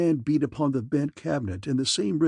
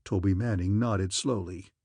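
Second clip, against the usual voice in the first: plain reading voice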